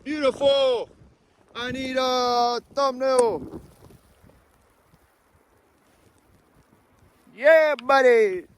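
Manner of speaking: excited squeaky high voice; high falsetto. Real, not acted.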